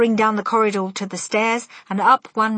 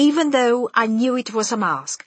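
usual speaking voice

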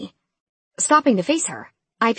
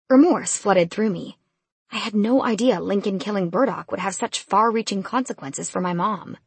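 plain reading voice

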